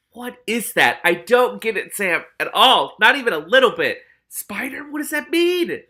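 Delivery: real loud bewilderment; baffled outburst. Real, not acted. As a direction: throw up your hands, loud and puzzled. Reader baffled by the plot.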